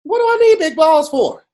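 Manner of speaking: normal talking voice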